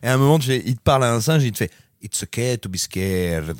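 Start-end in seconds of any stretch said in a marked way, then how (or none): 2.0-3.5 lower, slow mock English accent, mushy; bad English accent. Mocking an actor's clumsy English accent to a monkey. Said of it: "il essaye de faire l'accent anglais".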